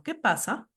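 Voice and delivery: QUÉ PASA asked as a direct question; direct question tune. Shown as a demonstration. Teacher showing a direct question, questioning tune. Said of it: "vamos a presentar formas de interrogación".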